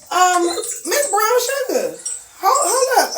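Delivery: high, slow, half-laughing challenge; slow her down with attitude; sassy hold up. Retold or imitated. Confronting the clerk, laughing.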